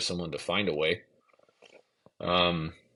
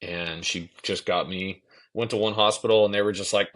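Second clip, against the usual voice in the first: ordinary talk, nothing marked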